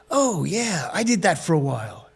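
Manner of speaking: casual put-on American accent. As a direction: play a laid-back American surfer. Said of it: "this is my American accent".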